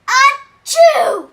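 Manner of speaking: big hammed-up sneeze, high and loud; loud fake sneeze. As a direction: blast out a pretend sneeze. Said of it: "I'll do the sound".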